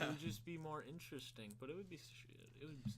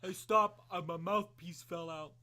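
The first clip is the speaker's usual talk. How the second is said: a much louder, higher call to stop the fight; mock fighter pleading; 4 semitones higher, 10 dB louder, slower than usual. Friend acting out a fighter halting the bout, mock plea. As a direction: play the fighter halting the bout over a mouthpiece.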